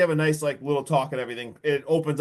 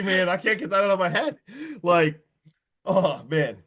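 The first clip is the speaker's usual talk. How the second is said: ordinary delivery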